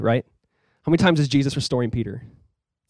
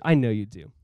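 softly and lower, tenderly reassuring; a gentle, hushed assurance; Jesus quietly reassuring Peter; 6 semitones lower, 5 dB quieter than usual. Retold or imitated. Jesus reassuring Peter, repeated line.

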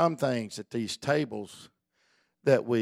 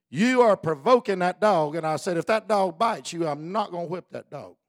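put-on louder, higher warning to a child; firm warning; 5 semitones higher, 6 dB louder than usual. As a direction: warn her sternly, stressing are and not. Warning niece in story.

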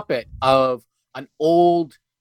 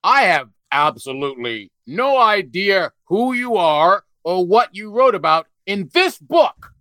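Larry King impression; 6 semitones higher, slower than usual. Mocking Larry King interview voice.

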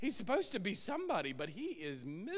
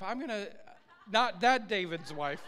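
ordinary talk

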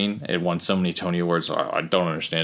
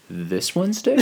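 shocked question; 7 semitones higher than usual. Retold or imitated. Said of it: "And I was like".

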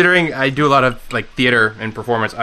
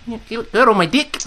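a higher, hammed-up Kiwi accent, pushed through the nose; more nasal Kiwi accent; 10 semitones higher than usual. Shown as a demonstration. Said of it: "you need to be a little more nasally".

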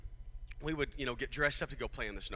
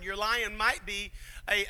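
usual speaking voice

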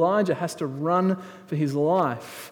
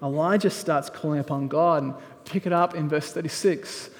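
higher, louder preaching that builds as the story goes; full-force preaching; 5 semitones higher, 6 dB louder than usual. Acted. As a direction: preach it out to the congregation with rising fire. Preaching Elijah showdown at full force.

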